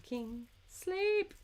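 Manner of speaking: high put-on voice, drawn out; mock sleepy command. Streamer quoting herself to a loved one, high silly voice. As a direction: coo it like a silly voice telling someone to sleep. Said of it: "When I'm like".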